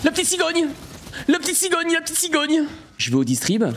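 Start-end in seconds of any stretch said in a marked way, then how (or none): 0.0-2.6 high-pitched repeated cheer; excited squealing. Real, not acted. Exclamation chantante en plein jeu. (exclamation aiguë et enjouée)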